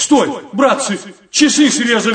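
громкий выкрик, выше обычного; гаркнуть на всю толпу; гаркнув. Acted. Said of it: "вдруг гаркнул". (гаркает)

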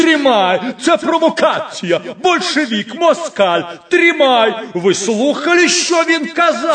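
«Тримай!» высоко и громко, с надрывом; злобно завопить, хватая вора; злобный крик. Acted. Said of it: "надтреснутый и злобный, и плаксивый". (злобный плаксивый крик)

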